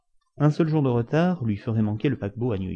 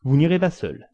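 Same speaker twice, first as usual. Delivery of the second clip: higher, louder, moved despite himself; moved outcry; 6 semitones higher, 6 dB louder than usual. A narrator's reading. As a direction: burst out, won over. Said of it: "s'écria le capitaine, ému malgré lui".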